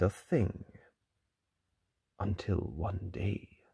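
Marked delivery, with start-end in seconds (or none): 2.2-3.6 slow hushed whisper; suspenseful whisper. A narrator's reading. Suspenseful narration.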